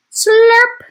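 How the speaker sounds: high, loud slurping noise. Acted. Dog kisses the burglar, loud slurping sound. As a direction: make a big wet slurp.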